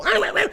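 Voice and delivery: very high mock puppy yelps; imitated puppy yelping. Puppy held down by its owner, imitated high yelping. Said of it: "Like, they'll go".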